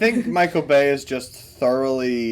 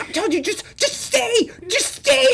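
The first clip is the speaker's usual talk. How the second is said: squeaky, mock-hysterical pleading; imitated tearful panic with laughter creeping in; Shia LaBeouf's character. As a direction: plead in a very high voice, near breakdown. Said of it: "on the verge of a breakdown".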